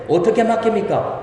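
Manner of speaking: normal talking voice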